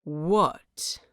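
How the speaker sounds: low, tight and held back; gritted-teeth anger. A narrator's reading. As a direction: force the word through clenched teeth. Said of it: "he grits out".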